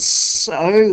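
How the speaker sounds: high, forceful wheeze; wheezed retort. A narrator's reading. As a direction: throw it back with a wheeze. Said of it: "he wheezed".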